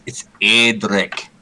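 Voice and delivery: "Edric" said slowly, ED-ric, stress on the first syllable; correct pronunciation. Shown as a demonstration. Player corrects the DM on the name, saying "Edric" slowly. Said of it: "D M, I have to say this".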